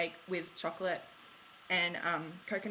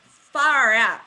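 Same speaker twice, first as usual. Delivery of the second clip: loud, exasperated exclamation; exasperated outburst; 2 semitones higher, 11 dB louder than usual. Real, not acted. Vlogger tripping over her own made-up word, loudly exasperated. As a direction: burst out loudly at your own fumble.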